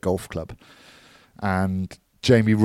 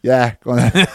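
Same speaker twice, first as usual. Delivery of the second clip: laughing agreement; 4 semitones higher, 6 dB louder than usual. Retold or imitated.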